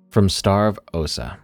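ordinary delivery, nothing marked

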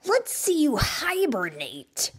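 mildly scoffing, stretching the key words; skeptical turtle. Acted. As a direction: dare him to prove it, unconvinced.

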